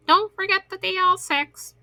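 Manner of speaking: squawky high parrot voice. Acted. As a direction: chirp it out like a parrot. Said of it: "I don't know how to do a parrot voice".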